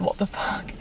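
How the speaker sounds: hushed, voiceless "What the fuck?"; whispered disbelief. Retold or imitated. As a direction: whisper it like a shocked adult in the cinema. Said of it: "every adult audibly said".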